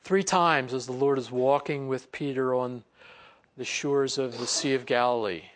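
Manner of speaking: ordinary delivery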